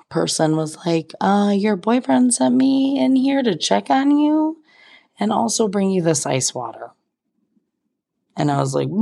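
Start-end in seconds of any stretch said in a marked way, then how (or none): 1.2-6.9 high, sweet, timid helper voice; a much higher, meek little voice; sweet stranger. Retold or imitated. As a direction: play the shy kind girl checking in.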